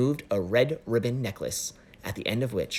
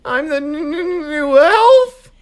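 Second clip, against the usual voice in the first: stuttering with cold; teeth chattering; 15 dB louder, slower than usual. Acted. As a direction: shiver and stammer the words. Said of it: "he repeated through chattering teeth".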